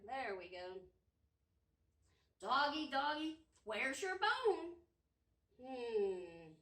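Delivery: normal talking voice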